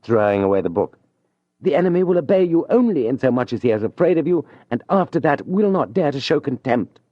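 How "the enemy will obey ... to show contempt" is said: higher, elated declaration; a proud young lover plotting aloud; triumphant young man. Acted. Julien pacing his room, exultant and resolved.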